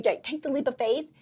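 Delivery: ordinary talk, nothing marked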